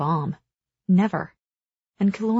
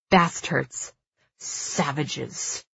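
restrained, furious hiss; contained rage, dropping in volume; hissing through gritted teeth; 3 semitones lower, 4 dB quieter, much slower than usual. A narrator's reading. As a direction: spit it out through clenched teeth. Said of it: "hisses through gritted teeth".

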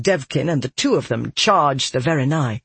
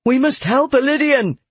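higher, urgent call for help; urgent cry; 10 semitones higher, 4 dB louder than usual. A narrator's reading. Captive watching Toriah's phoenix, urgent cry.

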